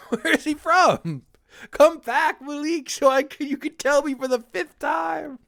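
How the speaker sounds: high, laughing mock plea to come back; playful high-pitched plea through laughter. Real, not acted. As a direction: call out laughing, begging him back. Calling out to absent friend.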